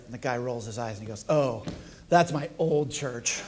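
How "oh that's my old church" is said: slow, dragged-out weary reply; eye-rolling punchline. Acted. Castaway sighing over his old church, slow and weary. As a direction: drawl it out like an old grudge.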